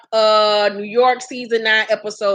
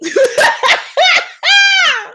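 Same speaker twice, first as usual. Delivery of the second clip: loud, high cackle; bursting out laughing; 5 dB louder than usual. Real, not acted. Host cracking up at own impression, loud high laughter. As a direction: lose it completely in a shrieking laugh.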